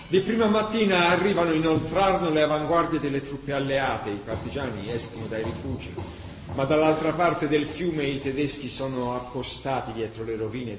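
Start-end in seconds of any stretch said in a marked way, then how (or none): none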